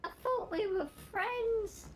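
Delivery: high, outraged betrayed squeak; shocked squeal. Real, not acted. Gamer squeaks after his friend bans his button. Reacting to ban, high filtered voice.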